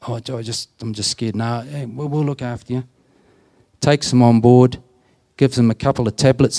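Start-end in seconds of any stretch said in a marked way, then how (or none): 0.1-1.4 put-on hushed, soft voice of a frightened young inmate; quiet frightened admission; timid scared whisper. 1.4-2.8 hushed, soothing tone of a too-friendly helper; quiet fake-kind comfort; soft reassuring. Friendly bloke reassures scared inmate.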